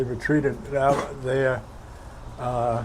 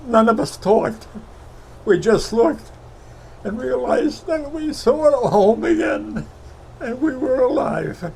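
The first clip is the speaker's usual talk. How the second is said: higher, choked-up voice, real tears close; emotional, voice breaking; 9 semitones higher than usual. Real, not acted. Veteran tells interviewer about seeing home, voice breaking. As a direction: fight back tears remembering home. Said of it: "I didn't mean to be so emotional".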